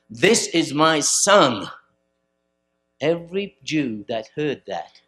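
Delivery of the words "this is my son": slow, loud, drawn-out declaration; slow heavenly proclamation. Retold or imitated. Preacher voicing heaven at the baptism, slow and loud. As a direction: let each word ring out from above. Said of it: "other times it says".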